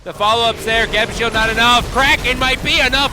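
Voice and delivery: loud, hyped teamfight call; excited play-by-play. Real, not acted. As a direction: shout the fight as it breaks open. Caster hypes a teamfight.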